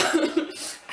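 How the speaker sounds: high airy laugh; breathless high laugh; burst of laughter. Real, not acted. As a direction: laugh out at the memory. Laughing at her own story.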